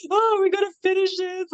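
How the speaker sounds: high-pitched, hammed-up panicky wail; retold tearful breakdown. Overwhelmed artist phoning her best friend mid-shoot, mock tearful breakdown. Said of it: "I had breakdown multiple times".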